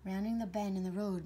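plain reading voice

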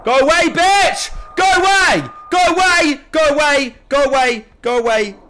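panicked screaming. Real, not acted. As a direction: scream to drive it off. Screaming at attacker in game.

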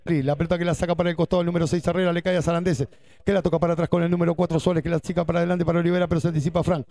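fast, rapid-fire match call; breakneck play-by-play. Real, not acted. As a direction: rattle off the play at speed. Live play-by-play.

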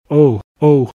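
loud, high cry of pain as the cakes burn; the miser yelps at the burning cakes, loud and high. A narrator's reading. Burned miser yelps out loud and high.

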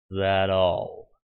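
low, slow menacing villain voice; villain taunt. A narrator's reading. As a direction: sneer it, unimpressed. Hades mocks the heroes.

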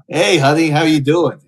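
loud, high leering lounge-lizard greeting; sleazy greeter. Acted. Streamer greets Omegle strangers as a leering creep, loud and high. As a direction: belt it out like a creepy host hitting on strangers.

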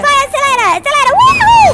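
high excited whoop; cheering. Real, not acted. Cheering in race game.

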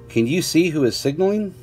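ordinary delivery

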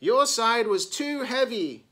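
high, loud retort; accusing cry. A narrator's reading. Simone throws the blame back at Meg, crying out. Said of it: "cried Simone".

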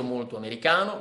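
usual speaking voice

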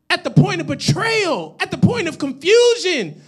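driving list, high and loud; full-force preaching. Real, not acted. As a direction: hammer each item with a punch on its last word.

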